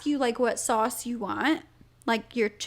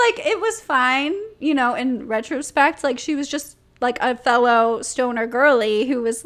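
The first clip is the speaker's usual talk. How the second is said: usual speaking voice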